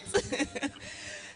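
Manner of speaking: a high, soft burst of laughter; breathy happy laugh. Real, not acted. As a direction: laugh with relief and delight. Laughing after getting name right.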